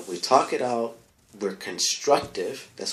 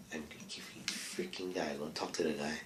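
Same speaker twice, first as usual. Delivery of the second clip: soft unvoiced whisper; hushed aside; 4 semitones lower, 11 dB quieter than usual. Real, not acted. Muttering to himself about the guy, in a whisper.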